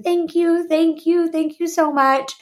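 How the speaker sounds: high, repeated weepy gratitude; mock tearful thanks. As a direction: gush thanks as if through tears. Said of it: "I'm hysterically crying".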